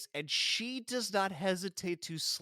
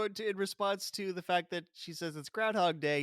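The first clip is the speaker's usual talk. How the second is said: ordinary talk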